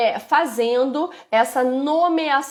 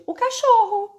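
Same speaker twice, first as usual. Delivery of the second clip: put-on much higher, small child answering; child naming the dog for the mother; child's voice; 11 semitones higher than usual. Shown as a demonstration. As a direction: chirp it like a proud toddler. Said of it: "E aí ela responde".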